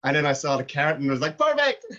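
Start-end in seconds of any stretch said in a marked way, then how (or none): 1.4-1.8 delighted exclamation. Retold or imitated. Quoting his own delight at beanie.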